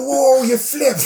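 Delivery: high, loud startled imitation; mock alarm. Storyteller voicing the opponent seeing him flip, alarmed. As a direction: act out shocked disbelief, nearly laughing.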